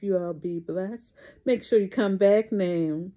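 ordinary talk, nothing marked

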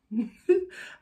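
sudden high burst of laughter; a loud laugh at the absurdity; quick laugh. Real, not acted. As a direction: let out a short disbelieving laugh. Amused laugh mid-story.

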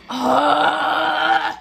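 loud drawn-out groan; real gamer groan; frustrated groan. Real, not acted. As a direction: groan loudly in exasperation. Frustration in game.

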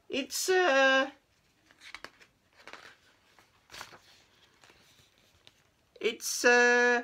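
high hesitant little voice; stall, unsure what to say; nervous cat voice. Acted.

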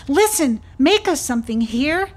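high, loud pleading; excited bugs begging for lace; bugs crying out. Acted. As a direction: call out eagerly to the snail.

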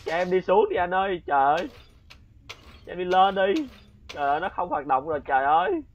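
frantic scared cries to get out; panicked pleading. Real, not acted. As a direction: beg the game to let you out, near tears of fright. Panicking, begging to escape the room.

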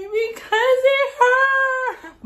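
high, slow whimpering cry; whiny crying. Retold or imitated. Answering her older sister, a high, slow whimpering cry. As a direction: whine it through tears like a hurt kid.

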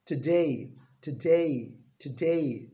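"Today" with a weak "tuh" first syllable; correct today. Shown as a demonstration. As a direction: drill "Today" with stress on "day". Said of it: "There's no call to".

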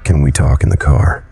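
usual speaking voice

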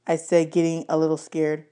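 usual speaking voice

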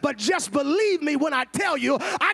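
loud, high, building sermon shout; preaching at full force. Acted. Preacher urging the church to nudge a neighbor, shouting.

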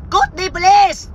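high, drawn-out dismissal; dismissive outburst. Real, not acted. Streamer telling a hater to get lost, dismissive outburst.

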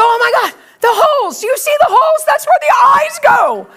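high, loud, mounting alarm; acted freak-out. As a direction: build to a shout of comic horror. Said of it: "I'm freaking out".

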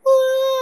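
very high, loud mock cry; one long piercing baby bawl; baby wail. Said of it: "esse choro dela é tão irritante". (berro de bebê chorando)

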